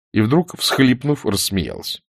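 ordinary delivery

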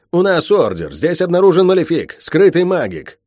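high, forceful official warning; shouted announcement. Acted. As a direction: declare it like a raiding officer.

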